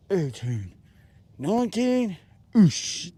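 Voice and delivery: counting through push-ups, straining; doing push ups. Real, not acted. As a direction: grunt out each rep. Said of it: "drop and give me twenty".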